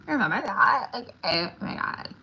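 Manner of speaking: put-on high, loud little-kid squeal; valley girl voice. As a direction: play a giddy five-year-old. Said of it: "I sound like a five-year-old".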